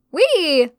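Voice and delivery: high excited squeal; gleeful. Acted. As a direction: squeal with joy. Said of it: "she enthused".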